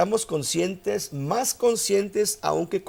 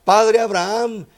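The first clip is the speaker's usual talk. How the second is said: calling out, higher, louder and slow; preacher reading the rich man's cry from the flames; a touch of an anguished call, drawn out; 5 semitones higher, 6 dB louder, slower than usual. Retold or imitated. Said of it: "dando voces".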